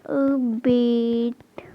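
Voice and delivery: अबेट drawn out very slowly, breathy; careful pronunciation. Shown as a demonstration. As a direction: spell out अबेट slowly for the class. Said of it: "ये इसका ना अबेट होगा".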